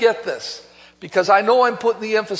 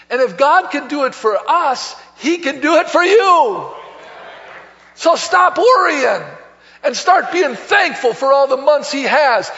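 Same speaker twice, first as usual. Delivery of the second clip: preaching at full force; 4 semitones higher than usual. Real, not acted. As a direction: build to a shouted call. Pastor's closing exhortation.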